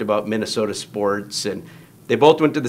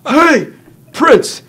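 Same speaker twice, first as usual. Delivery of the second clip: much higher, much louder shout calling out a name; yelling; 10 dB louder, much slower than usual. Retold or imitated. Old Sid yells to Prince across the hall, loud high shout. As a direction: bellow it down the hallway, high and loud. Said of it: "And Sid yells".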